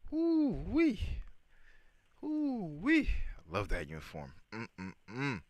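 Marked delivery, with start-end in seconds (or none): 0.1-3.1 high, loud ooh-wee; excited high whoop. Real, not acted. Admiring uniforms. 4.5-5.4 appreciative hum. Real, not acted. Admiring hum.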